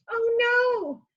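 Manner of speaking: high alarmed cry. Acted. Troll crying out, tumbling into the water. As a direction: squeal it as you fall.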